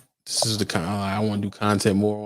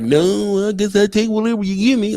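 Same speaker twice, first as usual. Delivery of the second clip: mock meek worker; 10 semitones higher than usual. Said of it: "being like".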